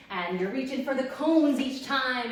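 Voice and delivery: normal talking voice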